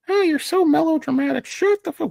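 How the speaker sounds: put-on cheeky kid squeaking back at a cowboy; high kid voice sassing the cowboy; squeaky child voice. Child answering Sam Elliott.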